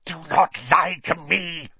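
dark lord hissing a warning; hissed. Acted. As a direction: hiss the order at him. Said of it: "hissed the second voice".

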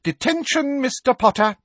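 very high, loud crow; shrill, gloating teacher voice; triumphant. A narrator's reading. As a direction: sing out the punishment in triumph. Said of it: "said Professor Umbridge triumphantly".

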